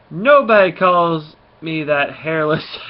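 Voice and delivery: loud, slow, emphatic threat; acted angry outburst. Rocket pulls his gun on the kid, acted outrage. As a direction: play it offended and loud, dragging out the words.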